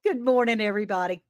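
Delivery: ordinary talk, nothing marked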